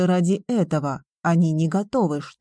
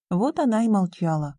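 plain reading voice

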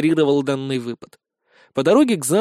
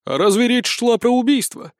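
sly; 8 semitones higher than usual. Acted. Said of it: "хитро подмигнув".

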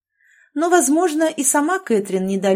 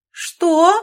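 very high, loud shriek; mother shrieking in disbelief; 10 semitones higher, 8 dB louder than usual. A narrator's reading. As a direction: squeal in outrage. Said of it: "снова взвизгнула".

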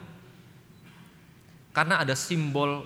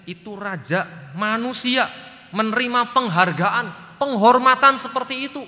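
building preaching; 9 semitones higher, 11 dB louder than usual. Real, not acted.

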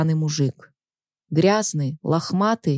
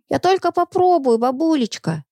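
put-on high whiny child voice; whining little girl; 9 semitones higher than usual. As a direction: whine like a begging kid. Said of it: "законючила".

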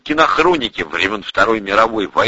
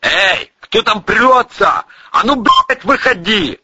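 loud, high sentry's shout; barked challenge; 13 semitones higher, 5 dB louder, slower than usual. A narrator's reading. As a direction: holler it down at intruders. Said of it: "рявкнули сверху".

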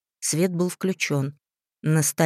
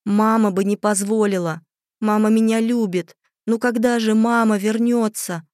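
higher, louder, wailing for mother; desperate sobbing plea; 5 semitones higher, 5 dB louder than usual. A narrator's reading. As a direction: plead through tears for mama.

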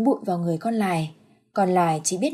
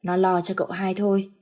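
usual speaking voice

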